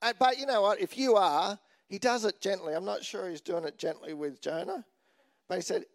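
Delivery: ordinary talk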